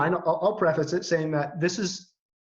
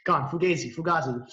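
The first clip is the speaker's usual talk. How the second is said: normal talking voice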